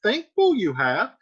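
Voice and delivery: high, measured Juliet voice; Juliet reading. Acted. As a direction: read as the calm daughter. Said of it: "And Juliet says".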